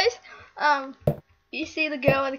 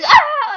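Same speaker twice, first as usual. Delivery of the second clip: scream; 13 semitones higher, 10 dB louder than usual. Retold or imitated. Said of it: "and she goes".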